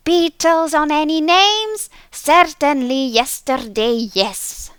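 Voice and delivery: high, loud, odd creature voice; voice calling back. A narrator's reading. Hidden creature answering the clerk, high calling voice. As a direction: call back in nonsense from hiding. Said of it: "the voice called back".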